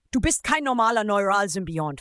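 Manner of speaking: ordinary delivery, nothing marked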